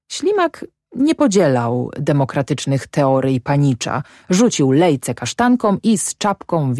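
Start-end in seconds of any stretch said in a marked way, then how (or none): none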